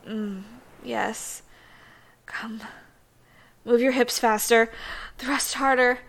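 urgent commands. Acted. Urging partner on.

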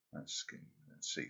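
whispered, drawn in close; teasing whisper. Acted. Lover exploring playfully, in a teasing whisper. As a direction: breathe it like a secret.

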